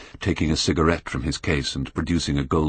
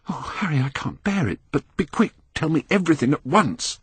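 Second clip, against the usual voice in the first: higher, urgent, distressed plea; pleading young man; 12 semitones higher than usual. Acted. Dorian begs Harry for the news, higher and distressed. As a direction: beg him to tell it quickly.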